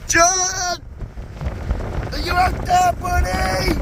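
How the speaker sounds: high, carrying call across the wind; calling from far away. Real, not acted.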